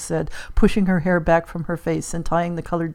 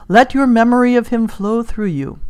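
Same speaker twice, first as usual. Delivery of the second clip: raised, commanding medium voice; higher and louder, summoning solemnly; séance medium; 6 semitones higher, 5 dB louder than usual. Acted.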